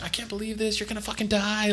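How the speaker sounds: high, hammed-up distraught girlfriend; mock crying. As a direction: wail it in a high put-on girl's voice. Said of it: "she's, like, crying".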